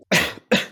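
sharp choking cough. Real, not acted. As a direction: cough something out of the throat. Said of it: "excuse me".

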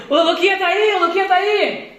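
high-pitched imitated call-out; retold shout. Bakery workers passing on the call for Luquinha, imitated shout. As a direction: pass the holler along, pitched right up.

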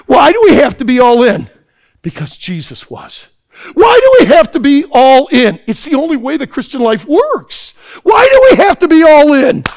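loud, high repeated challenge; preaching. Acted. Preacher pressing the camp with a question: full-force preaching. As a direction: hammer the question again and again.

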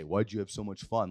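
low, mildly accusing question; mock disapproving. Shown as a demonstration. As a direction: ask it as if fun were wrong. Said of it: "Like, you shouldn't have fun".

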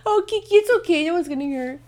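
high, sing-song comfort for a pet; soothing the dog. Real, not acted. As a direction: coo it to calm the dog.

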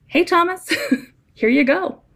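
playful chuckle. Retold or imitated. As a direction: hand it over with a chuckle, like a friend.

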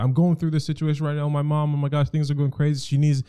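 imitating a stressed girlfriend; frantic partner. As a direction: rush it like everything's falling apart. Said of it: "she'll be like".

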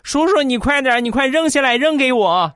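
put-on high, eager child begging uncle to hurry; squeaky impatient little kid; small niece pleading to have the cicada thrown down, high child voice; little girl voice. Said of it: "就跟我说".

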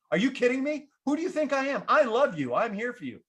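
indignant deflection. Retold or imitated. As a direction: act wounded to dodge the question. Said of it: "Form of deflection".

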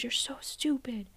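whispered, slow imitation of a father's yell; a mock yell turned to a hushed hiss; retold yell. Coach retelling client's childhood, whispered mock yell of a father. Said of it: "he just yelled at me really loudly".

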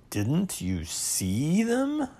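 slow, high, rising question; a little surprised. A narrator's reading. Owl questioning Pooh about the notices, a little surprised. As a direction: draw it out in mild disbelief. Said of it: "a little surprised".

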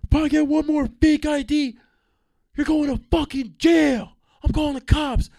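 high, loud barked threat; mock bouncer shout. Bouncer shouting at the line. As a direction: yell it like an angry bouncer.

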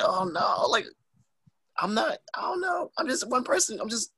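very high, mock-panicked voice; overwhelmed squeak. Wrestler overwhelmed by a retweet, high flustered squeak. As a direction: pitch it way up, playfully flustered. Acts out being overwhelmed by the attention.

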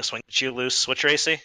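normal talking voice

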